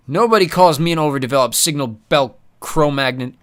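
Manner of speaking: loud offended thug; angry. Acted. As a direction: growl it out, insulted. Pex furious.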